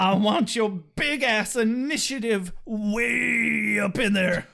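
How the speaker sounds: hammed-up dramatic reading. Mock Buffy making innuendos to Riley, hammed-up dramatic reading. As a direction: stretch it out like a lewd parody. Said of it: "Those are not the lines".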